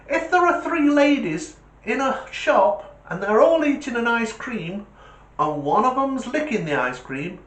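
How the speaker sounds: high, put-on schoolboy voice, slowing on the punch; cheeky kid voice. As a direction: spin the riddle like a cheeky pupil. [name] telling riddle.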